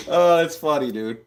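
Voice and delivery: ordinary talk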